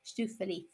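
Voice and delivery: estou feliz run as "shtoo fuh-LEE"; "estou feliz" reduced to shtoo-fuh-lee; elided Portuguese. Shown as a demonstration. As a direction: drop the E of "estou", soften the end of "feliz". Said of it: "completely lost because it's unstressed".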